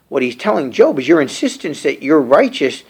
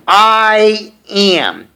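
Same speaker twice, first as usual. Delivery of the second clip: I AM held long, higher; emphatic I AM; 5 semitones higher, 4 dB louder than usual. Shown as a demonstration. Preacher contrasting it with I did, emphatic I AM. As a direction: stretch out I AM, ringing.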